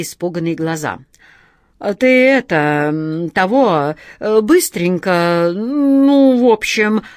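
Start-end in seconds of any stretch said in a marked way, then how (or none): 1.8-7.0 slow, halting, drawn-out fillers; flustered hesitation. A narrator's reading. Wizard groping for orders to the genie, slow and flustered. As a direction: stall loudly, stretching each filler. Wizard fumbling before frightened onlookers.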